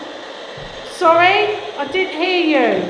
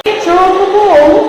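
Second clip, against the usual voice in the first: loud; 9 dB louder than usual. Real, not acted. Repeating question to the spirit box.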